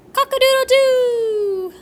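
high, loud rooster call; hammed-up rooster crow. A narrator's reading. Man crows like a rooster.

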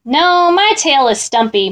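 plain reading voice

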